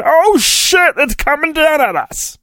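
high, panicked cry as the column falls; mock panic. Jedi under the falling column, in hammed-up high panic. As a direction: play the trapped Jedi shrieking.

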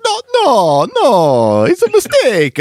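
high, hammed-up mock Italian; fake Italian accent. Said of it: "I do a good fake Italian".

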